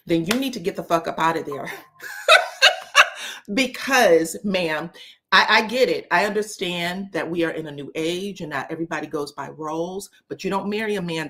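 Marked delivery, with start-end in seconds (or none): none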